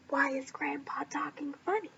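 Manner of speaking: soft, breathy secret question; a little girl whispering to her mom. Acted. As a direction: whisper it so Grandpa won't hear.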